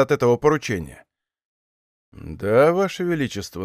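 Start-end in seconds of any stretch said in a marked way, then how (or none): none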